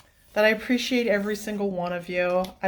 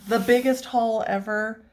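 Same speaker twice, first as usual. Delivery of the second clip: ordinary talk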